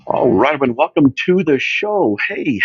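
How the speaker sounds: loud, high radio host welcome; big, high-energy show kickoff; show opener. Real, not acted. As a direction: belt out a big hello to the listeners.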